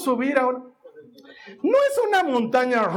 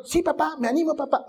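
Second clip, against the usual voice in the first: eager little daughter; 4 semitones higher, faster than usual. Acted. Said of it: "¿Te vas a animar, Nicole?".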